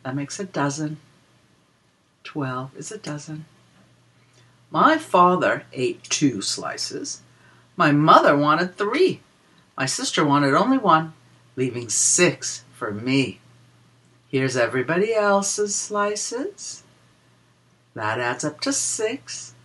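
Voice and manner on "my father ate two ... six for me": put-on lilting read-aloud for small children; a sing-song rhyme for little listeners; reading a picture book rhyme. Reads rhyming counting book verse.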